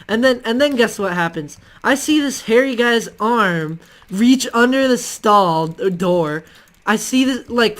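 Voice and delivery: usual speaking voice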